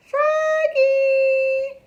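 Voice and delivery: very high, loud call; little sister shouts from the fence. A narrator's reading. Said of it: "called Frogalina".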